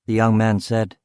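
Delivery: ordinary delivery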